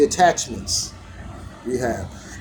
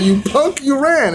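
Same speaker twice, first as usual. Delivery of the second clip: retold taunt; 9 semitones higher than usual. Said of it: "He said".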